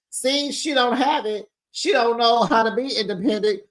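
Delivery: amused mockery; laughing. Real, not acted. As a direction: laugh at her while saying it.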